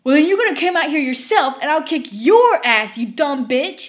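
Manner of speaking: furious customer shrieking; screaming. Retold or imitated. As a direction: scream it waving the cup. Customer threatening.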